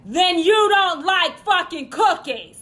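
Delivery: loud, exasperated parental rant; comic angry parent. Acted. Dad yelling at his whining kid over cookies, exasperated shout.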